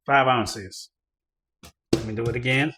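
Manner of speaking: usual speaking voice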